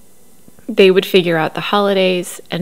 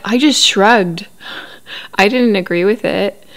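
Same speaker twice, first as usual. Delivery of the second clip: mocking Cody; 2 semitones higher, 3 dB louder than usual. Recapping Cody's excuse to viewers, mock-dopey voice. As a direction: mimic Cody's lame shrug defense.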